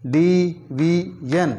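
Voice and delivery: Division stretched slowly, syllable by syllable; slow syllable drill. Shown as a demonstration. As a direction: draw division out in slow parts. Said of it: "syllable drilling". (Division को धीरे खींचकर बोलना)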